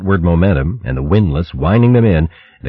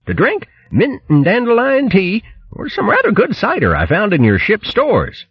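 much higher, tongue-twisting boast; boastful posh hare. Acted. Bo boasting to the crew of his cooking, high and pompous. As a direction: crow about it like a pompous hare. Bo reads the menu.

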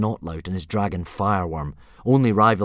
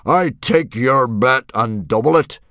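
loud, slow, raised-pitch bellow; hearty yell; 6 semitones higher, 11 dB louder than usual. A narrator's reading. Chief accepting his rival's bet, yelling heartily. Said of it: "slapped Stoic on the back and yelled".

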